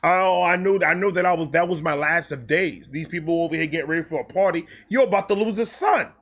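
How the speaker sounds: usual speaking voice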